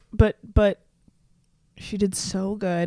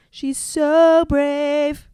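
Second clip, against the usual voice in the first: cooing praise; 8 semitones higher, 6 dB louder, much slower than usual. Real, not acted. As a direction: coo it like praising a puppy. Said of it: "coos at the dog".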